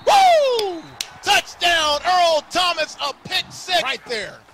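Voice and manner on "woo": loud high whoop; excited whoop. Real, not acted. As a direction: let out a big excited whoop. Huge touchdown call.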